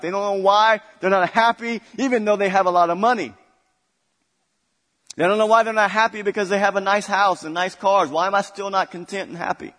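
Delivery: loud, lifted pulpit delivery; fired-up preaching. Real, not acted. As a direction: drive the point home like an impassioned preacher.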